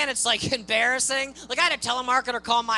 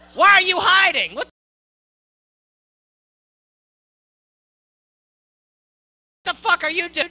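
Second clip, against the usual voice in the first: louder, higher mock yell at the cop; mock-furious shout from the car; 5 semitones higher, 7 dB louder than usual. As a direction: bellow it at the hidden cop. Yelling at hiding cop.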